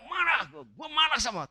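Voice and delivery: high, acted angry outburst; expressive anger. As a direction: blurt it out like a hothead. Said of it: "langsung kalau marah".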